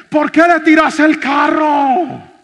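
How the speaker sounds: slow, loud, high shout from a bystander; shopkeeper yelling. Retold or imitated. Shopkeeper shouts at the pastor running the thief down, slow yell. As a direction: call out the driver across the street. Shopkeeper yells at speeding driver.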